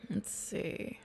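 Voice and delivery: soft unvoiced whisper. Real, not acted. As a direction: breathe it under your breath. Whispered aside.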